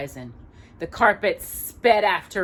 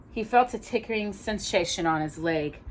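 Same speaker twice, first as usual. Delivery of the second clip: ordinary delivery, nothing marked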